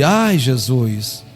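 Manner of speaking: normal talking voice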